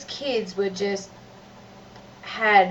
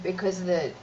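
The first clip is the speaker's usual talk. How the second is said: usual speaking voice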